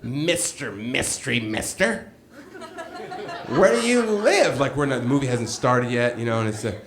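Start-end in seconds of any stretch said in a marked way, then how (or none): 0.0-4.6 slow, teasing come-on through a laugh; a hint of a coy admirer, drawn out; flirty guy voice. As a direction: lightly play the smooth flirt fishing for info. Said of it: "he says".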